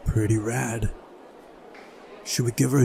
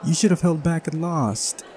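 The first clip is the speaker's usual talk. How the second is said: higher put-on girl's voice, accusing; sulky girl character; 6 semitones higher than usual. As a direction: scold the winner as a sore loser. Voicing Uliana, sore loser.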